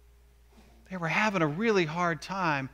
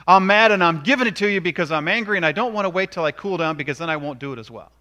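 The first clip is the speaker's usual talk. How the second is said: louder, fast, heated mock rant; mock hothead; 2 semitones higher, 9 dB louder, faster than usual. Angry person refusing to cool down, mock heated rant. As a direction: rush it out like someone who can't wait to vent. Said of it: "You ever do that?".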